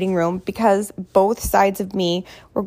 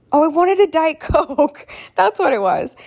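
sudden realization; 7 semitones higher than usual. Real, not acted. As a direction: blurt out the memory. Sudden recollection.

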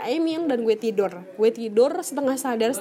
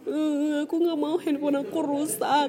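put-on higher, childlike complaining to a father; whiny pleading prayer; 4 semitones higher than usual. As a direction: plead like a child tattling to dad. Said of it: "seperti bagaimana anak menangis kepada bapaknya". (merengek seperti anak mengadu ke bapaknya)

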